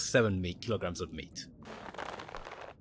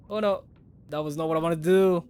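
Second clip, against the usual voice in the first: high-pitched, louder dismay at a slip; 6 semitones higher, 5 dB louder than usual. Real, not acted. Gamer to viewers after a wrong move, dismayed. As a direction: blurt it out as a real "oops". Gamer reacting to own mistake.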